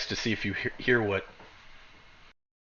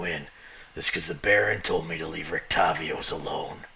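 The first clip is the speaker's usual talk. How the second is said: slightly lower, firm arresting officer; lightly voiced menacing guard; 5 semitones lower, 4 dB quieter than usual. Guard arresting Raven before the party, lightly menacing. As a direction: give the guard a lower, threatening edge.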